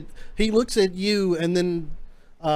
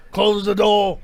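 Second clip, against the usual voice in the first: louder captain's command, in character; pirate captain's order; 3 semitones higher, 7 dB louder, slower than usual. Acted. Captain dismissing eavesdroppers from a private talk, firm command. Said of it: "The captain looks and goes".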